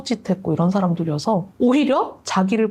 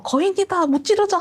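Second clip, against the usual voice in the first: very high mock battle cry; 10 semitones higher than usual. Don Quixote charging the windmills, a very high mock battle cry. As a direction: charge in with a heroic shout.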